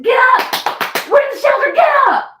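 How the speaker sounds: screaming them awake at full force; frantic shout. Retold or imitated. Waking her son and his wife, shouting in panic. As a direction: yell get up as loud as you can. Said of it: "that's how I bust through that door".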